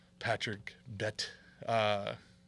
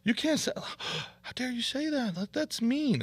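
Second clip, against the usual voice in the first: whiny offended comics; 13 semitones higher than usual. Retold or imitated. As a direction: whine like a hurt comedian, pitched way up. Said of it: "And the guys were like".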